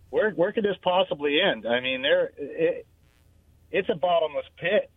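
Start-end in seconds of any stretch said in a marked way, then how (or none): none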